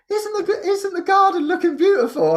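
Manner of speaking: high-pitched cooing over something lovely; imitated gushing admiration. Visitors to the garden admiring it, gushing in a high voice.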